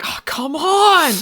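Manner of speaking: high, loud, drawn-out complaint; hammed-up frustrated demon; exasperated mock yell. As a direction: whine it loudly in exasperation. Possessing thing exasperated at host.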